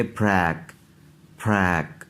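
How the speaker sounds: फ्रैक with a short ae; model pronunciation. Shown as a demonstration. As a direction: repeat the syllable clearly. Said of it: "इसको पढ़ेंगे". (ऐ के साथ फ्रैक)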